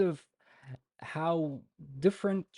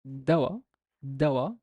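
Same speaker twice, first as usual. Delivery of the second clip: "Dawa" louder, with an upward tick; stress with upper tick; 6 dB louder than usual. Shown as a demonstration. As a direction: lift "Dawa" with a rising tick. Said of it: "upper tick of my pronunciation".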